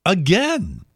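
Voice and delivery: ordinary delivery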